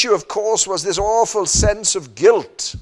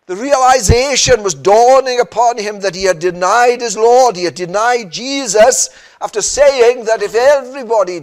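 louder, driving pulpit delivery; full-force preaching; 4 semitones higher, 10 dB louder than usual. Acted. Preacher driving home Peter's denial, full force. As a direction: preach it hard to the pews.

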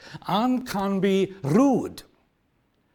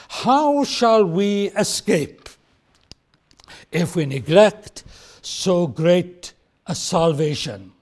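very slow, weighty question; dramatic reading; slower than usual. Shown as a demonstration. Reading the morning's text, slow and dramatic. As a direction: let each word weigh heavy. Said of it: "dramatic effect".

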